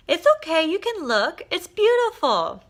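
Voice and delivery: high, tender, slowed coaxing voice; gentle reassurance. Retold or imitated. Mother encourages her little boy to look, tender. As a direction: coax him kindly like a mother.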